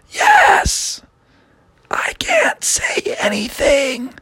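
high airy whisper, slow; just breathe the words out; voiceless whisper. Acted. Voiceless princess answering, whispered. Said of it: "She's like".

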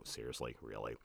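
very low, hushed aside; deadpan disbelief. Retold or imitated. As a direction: drop right down into unimpressed disbelief. Teacher's acted disbelief at the joke.

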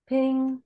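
a high imitated sonar ping. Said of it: "That's the sound of the echo sonar".

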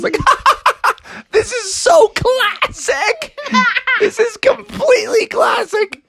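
high-pitched words through laughter; laughing hard. Pretend. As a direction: gasp it out between laughs.

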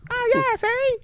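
very high, squawky cartoon voice; Terrence and Phillip. Friend spoofing a South Park duo, high cartoon voice. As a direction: rattle it off in a piercingly high cartoon pitch. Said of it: "Sound like Terrence and Phillip from South Park".

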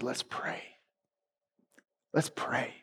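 barely voiced hush, breathing the words out; a near-silent whisper at a deathbed; whispered gently in the hospital room. Wife's quiet call to prayer.

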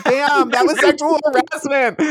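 high, put-on gasp of dawning outrage; mock shocked realisation. Friend quoting their younger self's high mock-shocked realisation. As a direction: play it like you just realised what happened.

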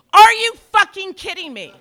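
loud, high disbelief with a laugh; laughing outraged shout; incredulous shout. Acted. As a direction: yell it in disbelief. Outraged punchline.

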